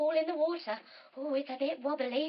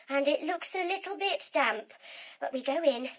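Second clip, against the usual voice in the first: usual speaking voice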